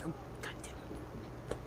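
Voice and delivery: slow, breathy whispered "Oh, God damn it"; whispered curse. Real, not acted. Scolding himself for cursing, whispered under his breath. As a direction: hiss the curse under your breath at yourself. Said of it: "reprimand myself for cursing".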